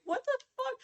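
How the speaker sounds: squeaky, very high disbelief; shocked outburst. Retold or imitated. Gamer reacting to the character's confession, shocked squeal. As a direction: yelp it in total disbelief. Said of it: "I was like".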